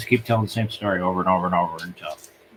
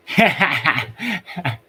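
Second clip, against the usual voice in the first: high-pitched burst of chuckles; real, rapid laughter; 6 semitones higher, 3 dB louder than usual. Real, not acted. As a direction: laugh at your own aside. Laughs at his own aside.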